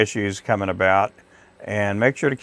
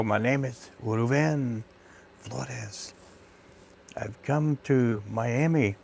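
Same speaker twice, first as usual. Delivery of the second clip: put-on accentless self-introduction; 9 dB quieter, slower than usual. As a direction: say your name slowly and neutrally, a mild put-on.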